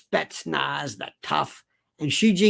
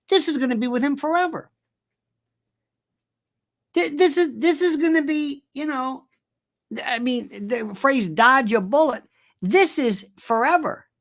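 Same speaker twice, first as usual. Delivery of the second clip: high-pitched, rushing excitement; excited; 8 semitones higher than usual. Real, not acted. Comic riffing to the crowd, pitch climbing: excited. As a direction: get worked up and climb higher. Excited rant about Trump.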